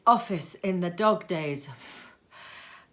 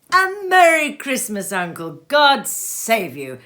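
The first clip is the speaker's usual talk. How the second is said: bright, loud call, much higher and louder; cheerful cry; 5 semitones higher, 10 dB louder than usual. Acted. Nephew bursting in to greet his uncle, loud and cheerful. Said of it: "cried a cheerful voice".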